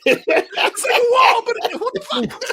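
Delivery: slow, very high squeal through laughter; mock perplexed. Alien physicist confronted with a wall, mock perplexed squeal. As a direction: laugh through hammed-up bewilderment. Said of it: "Just perplexed".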